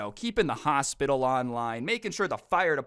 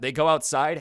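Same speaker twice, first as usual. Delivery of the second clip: normal talking voice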